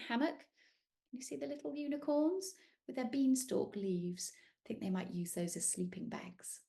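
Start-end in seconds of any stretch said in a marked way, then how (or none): 4.3-6.6 soft whisper. Real, not acted. Storyteller sharing a secret, whispering. Hushed aside.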